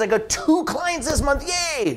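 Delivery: high, bright mock cheer on yay; mock upbeat outside voice. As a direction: put on a show of delight. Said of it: "the outside voice says".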